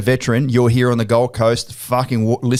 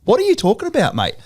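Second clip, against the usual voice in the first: high mock disbelief; incredulous; 12 semitones higher than usual. Telling the client he doubted him, high and incredulous. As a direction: pitch it way up, can't believe it.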